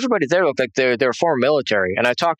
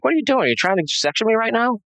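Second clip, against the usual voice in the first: much higher, indignant outburst; outraged; 9 semitones higher than usual. Retold or imitated. Husband confronting the doctor over his wife, higher and outraged. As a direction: fire it back at him, appalled.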